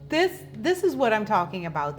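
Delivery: ordinary talk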